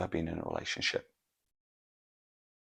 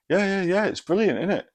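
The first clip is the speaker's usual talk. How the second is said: much higher, louder, hammed-up yeah yeah yeah; daft friend voice; 13 semitones higher, 7 dB louder than usual. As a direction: play the clueless mate gushing. Friend gulps wine, enthuses.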